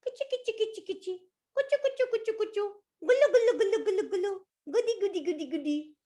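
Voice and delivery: high, playful tickle sounds; tickling baby talk. Acted. Cow tickling the elephant with playful high baby noises.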